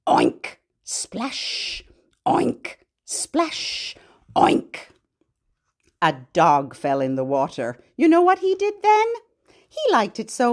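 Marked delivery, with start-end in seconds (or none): 0.1-4.9 oink like a pig. Pretend. Teacher voices the pig in the lake. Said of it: "Pig says".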